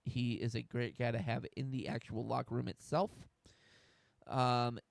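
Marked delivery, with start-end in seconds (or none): none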